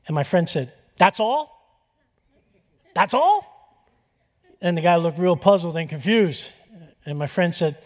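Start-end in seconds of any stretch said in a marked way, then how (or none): 1.0-3.9 high, loud rising disbelief that it's so few; mock surprise. Friend to inmate who killed six or seven, high mock surprise. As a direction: act amazed it's only that many.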